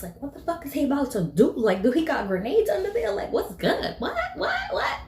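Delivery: high, fast, amazed; excited disbelief. Real, not acted. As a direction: pitch up in wild excitement. Reacting excitedly to badass guy.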